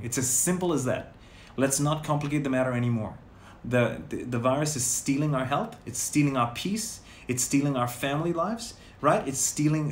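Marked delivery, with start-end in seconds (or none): none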